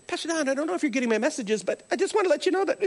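high, fast, anxious apology; flustered apologetic teacher. Retold or imitated. Teacher leaving panicked messages for Pastor Don, flustered. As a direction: gush out a nervous apology. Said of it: "She called back".

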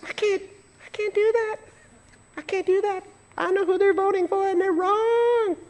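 very high, soft mock protest; whiny objector. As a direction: imitate a squeaky little complainer, dragging the last words. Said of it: "I could just hear somebody going".

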